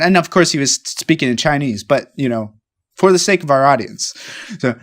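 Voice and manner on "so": ordinary talk, nothing marked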